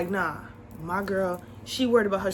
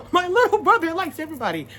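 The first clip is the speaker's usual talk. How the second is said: high, laughing repeat; 6 semitones higher, 5 dB louder than usual. Real, not acted. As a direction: crack up, then push it out higher. Cracks up repeating her line.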